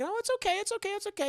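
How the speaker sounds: calm them down sweetly; soothing high reassurance. Retold or imitated. Nun soothing the parents.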